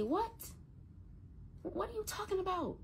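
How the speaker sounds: high, soft voice of disbelief; stunned, high-pitched questioning; retold shock. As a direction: ask it high and hushed, as if stunned. Retelling her shocked reaction to son.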